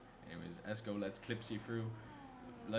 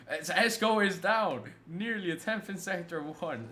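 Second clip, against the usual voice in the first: slow, louder and higher excited shout; excited call; 6 semitones higher, 7 dB louder, slower than usual. Real, not acted. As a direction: call the split like it matters. Live lap, sector time drops.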